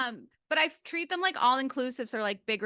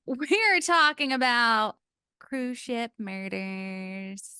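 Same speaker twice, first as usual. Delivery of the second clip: slow dramatic reveal; much slower than usual. Acted. Dramatic topic reveal, slowed.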